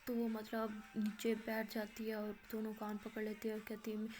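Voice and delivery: ordinary delivery, nothing marked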